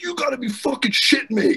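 high-pitched mock outrage; imitated angry coach; pissed-off coach. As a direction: rant like an exasperated coach. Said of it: "He's completely pissed".

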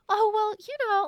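a high ditzy "Oh, well, you know" voice; high-pitched dumb voice. Shown as a demonstration. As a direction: act sweetly clueless, up high. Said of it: "the high-pitched "Oh, well, I'm dumb" voice".

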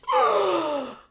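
loud high gasp of shock; sharp gasp. Real, not acted. Shocked reaction to story.

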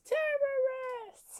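shrieked high panicked scream; yelling. Retold or imitated. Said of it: "and she yells".

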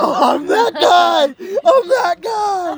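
high, loud wailing imitation; hammed-up anguished cry; yelling in pain. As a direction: wail it out like the injured friend. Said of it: "and he's yelling".

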